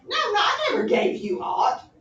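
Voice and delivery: high, loud mocking denial with a laugh; sarcastic. Teacher as Hamlet mocking Ophelia, sarcastic. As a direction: scoff at her, sarcastic and shrill. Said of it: "He's being his sarcastic self".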